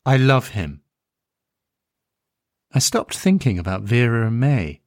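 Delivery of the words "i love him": firm and certain, said with new resolve; a father voicing the mother declaring her love plainly; renewed sureness. A narrator's reading. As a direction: commit to it this time, no hedging. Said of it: "with revigorated surety".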